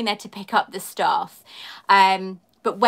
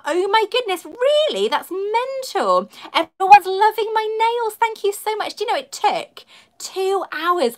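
high, bubbling thrilled gush; squealing with delight at the praise; excited delight; 10 semitones higher, 3 dB louder than usual. Real, not acted. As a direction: gush over the compliments, all lit up. Delighted reading out nail compliments.

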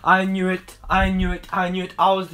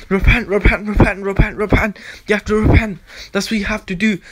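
fervent preaching; 3 semitones higher, 4 dB louder than usual. Acted. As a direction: cry out repent at full force. Calling viewers to repent.

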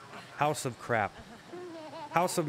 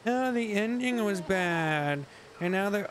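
higher, slow whiny complaint; whiny critic mimic; 8 semitones higher, slower than usual. Mocks show critics complaining to viewers, whiny and slow. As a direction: drag it out like a moaning fan. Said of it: "cry around and say".

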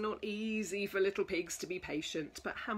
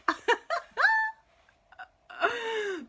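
laughing goodbye; 6 dB louder, faster than usual. Real, not acted. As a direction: laugh as you wave goodbye. Reader laughs signing off.

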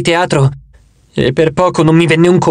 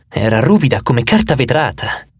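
plain reading voice

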